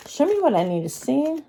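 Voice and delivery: ordinary talk, nothing marked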